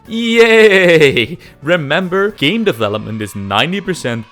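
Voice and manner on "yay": ordinary talk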